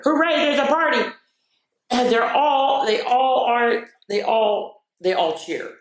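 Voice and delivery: bright, lively storybook voice; excited picture-book reading; reading to children. Acted. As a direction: read it like a party is coming.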